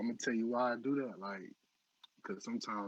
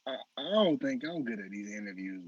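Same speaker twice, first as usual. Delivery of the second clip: ordinary talk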